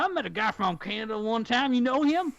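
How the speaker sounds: lightly voiced American accent, rising and chatty; a light American accent, nosy and friendly; American impression. Canadian guy imitating an American asking about Canadians, light American accent. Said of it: "That's my American impression".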